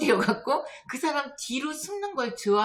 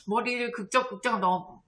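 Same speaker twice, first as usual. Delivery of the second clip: ordinary talk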